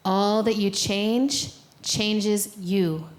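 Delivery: slow, measured recital for the room to echo; a slow call-and-response chant of a poem; slow recited verse. Shown as a demonstration. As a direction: intone each line slowly with long pauses, like a call to repeat. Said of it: "Octavia teaches us these words, and you can repeat them back to me".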